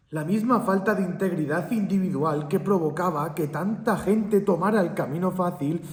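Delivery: arenga moralizante cada vez más alta y fuerte; tono de sermón. Acted. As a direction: sermonea con fuerza, sube la voz y el tono. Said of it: "Esto parece un sermón". (sermón indignado, voz alta y aguda)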